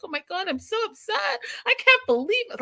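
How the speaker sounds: high-pitched hammed-up distress; mock meltdown. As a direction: wail it like a self-pitying meltdown in the mirror. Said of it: "have a full-on meltdown and look at myself".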